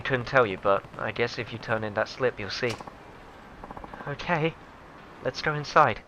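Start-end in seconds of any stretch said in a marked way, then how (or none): none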